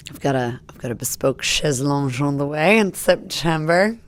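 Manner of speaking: light French-accented pronunciation. Shown as a demonstration. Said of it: "you do it in a French accent".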